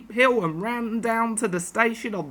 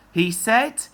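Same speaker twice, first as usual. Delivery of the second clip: plain reading voice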